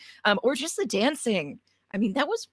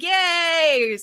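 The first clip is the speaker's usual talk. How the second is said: cheering; 8 semitones higher, 7 dB louder than usual. Retold or imitated. GM imitates the crowd cheering.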